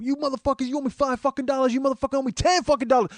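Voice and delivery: high-pitched imitated rant; mock tirade, high but not at full volume; retold yelling. Scalper turning on two homeless dudes, retold yelling. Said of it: "you just starts yelling at those two".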